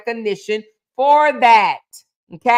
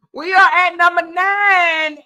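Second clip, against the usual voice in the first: higher and louder, announcing the next number; excited announcement; 7 semitones higher, 7 dB louder than usual. Real, not acted. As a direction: call out the next item with a burst of energy. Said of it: "Woo, child".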